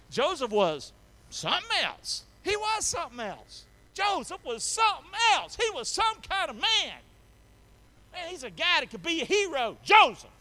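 builds from strong to a high, loud shout; rising preacher's shout. Real, not acted. Pastor to the congregation, climbing to a high shout.

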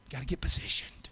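whispered, hushed; a whispered reminder to the congregation. Shown as a demonstration. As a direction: breathe it like a secret. Repeated phrase, whispered take.

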